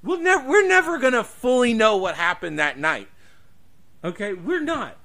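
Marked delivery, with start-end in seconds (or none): none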